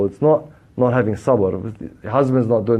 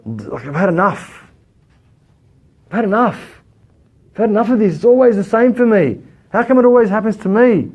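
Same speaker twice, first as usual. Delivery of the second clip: rising, louder fed-up whine; irritated complaining; 8 semitones higher, 3 dB louder than usual. Retold or imitated. Preacher mimics an irritated believer, fed-up whine. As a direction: act out someone who's had enough.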